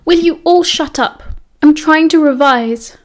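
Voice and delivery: high, loud, furious outburst; angry yell. A narrator's reading. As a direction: snap at them to shut up. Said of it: "he yelled".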